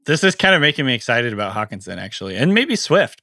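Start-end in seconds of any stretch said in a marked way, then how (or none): 2.4-3.2 a sudden crack in the voice. Real, not acted. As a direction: voice cracks on the words. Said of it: "my voice just cracked a little bit".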